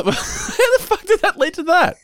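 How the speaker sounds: very high, squeaky laughing question; helpless with laughter, words stretched; laughing disbelief. Real, not acted. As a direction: barely get it out through laughter. Host cracking up at the tangent.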